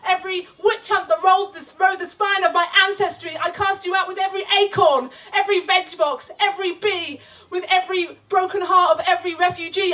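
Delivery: rousing spoken-word climax; building incantation. Acted. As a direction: lift the crowd, building in force.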